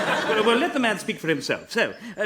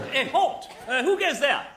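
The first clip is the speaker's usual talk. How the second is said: raised, higher-pitched challenge; sentry's challenge; 6 semitones higher than usual. Acted. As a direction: call out to halt strangers.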